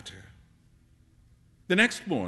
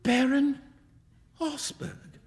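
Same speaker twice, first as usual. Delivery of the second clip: high, stunned echo of a name; shocked cry; 12 semitones higher, slower than usual. A narrator's reading. Young man learning beggar is a baron, shocked. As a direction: cry it high, then lose breath. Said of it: "cried Hughie".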